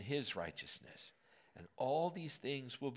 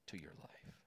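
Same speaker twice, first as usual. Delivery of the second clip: sudden breathy whisper to close the question; 7 semitones lower, 17 dB quieter than usual. Acted. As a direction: drop to a hushed whisper for effect. Scripture reading, dropped to whisper.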